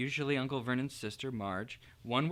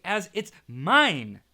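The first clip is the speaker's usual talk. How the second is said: said furiously; 5 semitones higher, 9 dB louder, much slower than usual. A narrator's reading. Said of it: "Harry said furiously".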